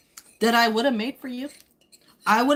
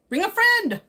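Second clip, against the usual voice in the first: FRIEND pitched way up, then falling; high singsong call; 9 semitones higher, 4 dB louder than usual. Pretend. Streamer urging Jorge to bring someone along, high singsong call.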